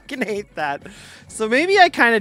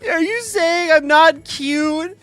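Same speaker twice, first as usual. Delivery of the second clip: high, whiny fake sob, slowing down; mock crying; 8 semitones higher, 6 dB louder than usual. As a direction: ham up being offended, fake-crying. Mock hurt at a viewer's joke.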